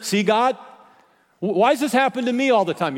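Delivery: mock aggrieved whine; exasperated whine. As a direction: throw the question at God, aggrieved. Acted exasperated complaint.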